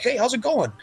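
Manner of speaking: high goofy chatter; imitating Adam. Mocking a friend chatting up restaurant staff, high goofy voice. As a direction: babble on high like a clueless buddy. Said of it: "Adam's all like".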